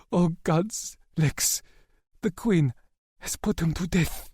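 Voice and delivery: high, halting, tearful breaking of bad news; grieving confession. A narrator's reading. Asher telling Lex Rory is dead, halting and tearful. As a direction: break it to him gently through grief.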